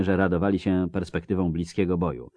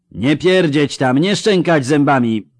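roar; 8 semitones higher, 7 dB louder than usual. Acted. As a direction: roar down the ranks. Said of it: "ryknął Bronibor". (Bronibor ryczy na przestraszonych pikinierów)

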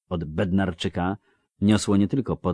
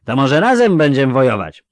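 loud, higher, slurring tipsy villager; drunk Melfi; 8 semitones higher, 14 dB louder than usual. Acted. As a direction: call out like a cheery drunk. Melfi asks. (pijany Melfi, głośno i bełkotliwie)

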